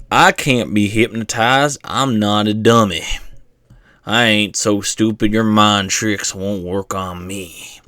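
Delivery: slow Southern drawl of a stubborn skeptic; southern accent. Retold or imitated. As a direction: lay on a thick Southern accent, unhurried. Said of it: "Sorry for the southern accent".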